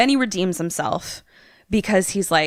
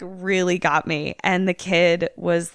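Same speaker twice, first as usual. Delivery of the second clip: ordinary talk, nothing marked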